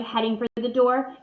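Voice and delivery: ordinary delivery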